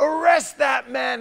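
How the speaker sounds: loud, high, drawn-out order; bellowed royal order; king's shouted command. Acted. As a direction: shout it like a furious king. King's order, retold again.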